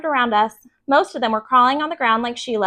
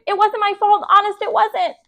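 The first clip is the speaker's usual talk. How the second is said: put-on high, anxious child's plea; a lightly voiced worried girl insisting; upset pleading; 10 semitones higher than usual. A narrator's reading. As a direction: beg to be believed, panicky. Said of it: "Sheila cried".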